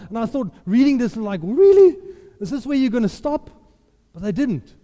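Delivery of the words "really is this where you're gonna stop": loud, high really; incredulous. Retold or imitated. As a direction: scoff in disbelief. Incredulous reaction to scripture.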